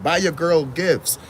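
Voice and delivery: ordinary talk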